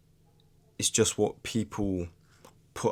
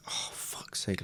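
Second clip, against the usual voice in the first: exasperated under-the-breath curse, much softer; retold frustration; 11 dB quieter, slower than usual. Recalling Sunday-evening dread of going back to work, muttered frustration. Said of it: "it's so frustrating".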